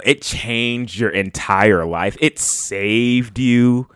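drawn-out skeptical mockery, stretching the key words; milk every word in mock wonder; mock disbelief. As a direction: scoff slowly at an overblown claim. Mocking others' dramatic song claims.

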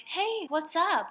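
drawn-out, high-pitched cheery greeting; cheery and chipper. Retold or imitated. Guy phones her out of the blue, cheery and chipper. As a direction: greet brightly with a long, high "hey". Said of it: "All cheery and chippery".

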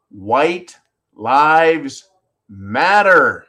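very slow, word by word; dramatic reveal. Real, not acted. Host revealing what the shirts said, slow and emphatic. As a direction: draw out each word for the reveal.

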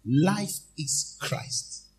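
very slow, stressed answer; slow and drawn out. Acted. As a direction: lay each word down heavily. Congregation answer modelled.

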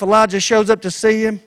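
ordinary delivery

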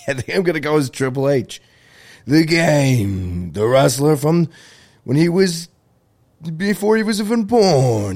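lightly hammed-up wrestler announcing himself; Triple H voice. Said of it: "that's how he talks".

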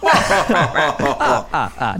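Count laugh. Retold or imitated. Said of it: "the Count from fucking Sesame Street".

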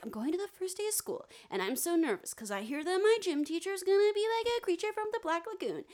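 high, jittery schoolkid voice; squeaky, worried first-day kid; nervous kid voice. Retold or imitated. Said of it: "and he's like".

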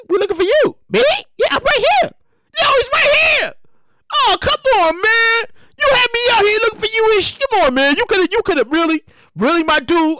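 high, loud, fed-up complaint; exasperated searcher. Retold or imitated. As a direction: scold him for wasting your time. Search party exasperated reply.

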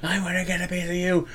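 a hint of a hothead held back; angry wrestler challenge. Seth Rollins calling out Nakamura, mild mock anger.

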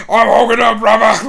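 loud, high wrestler roar; hamming up a wrestling promo catchphrase; Hulk Hogan impression. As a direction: bellow it like a pumped-up pro wrestler. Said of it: "Hulk Hogan ripped the shirt".

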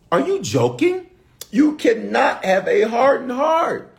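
high, loud disbelief, then slow; are you joking, said with mock shock; incredulous. Real, not acted. Preacher scolding the church, high incredulous outburst.

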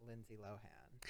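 slow, breath-only whisper; hushed reveal. Real, not acted. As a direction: whisper the name slowly as a big reveal. Dramatic reveal of her pick.

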